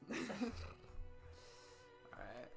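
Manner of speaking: ordinary talk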